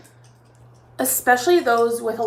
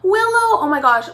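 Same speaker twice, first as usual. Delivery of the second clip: high, loud squeal of a name; excited; 8 semitones higher, 8 dB louder than usual. Real, not acted. Aunt spotting her niece in chat, high excited squeal. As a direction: spot the niece and light up.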